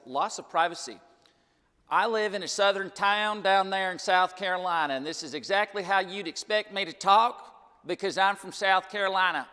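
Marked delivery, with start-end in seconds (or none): 1.9-9.5 broad South Carolina Southern accent, higher and louder. Shown as a demonstration. Seminar speaker showing the audience the South Carolina Southern accent. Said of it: "but I don't talk like that".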